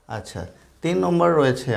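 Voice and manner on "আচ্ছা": normal talking voice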